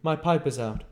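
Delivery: a lightly voiced curt stranger; a hint of the stranger; stranger's voice. A narrator's reading. Visitor voiced.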